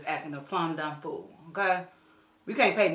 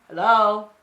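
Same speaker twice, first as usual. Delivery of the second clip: loud sing-song hello; 3 semitones higher, 10 dB louder than usual. Real, not acted. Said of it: "greeting viewers playfully".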